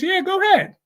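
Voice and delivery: high, light answering voice; mock reply. Acting out the other person's reply.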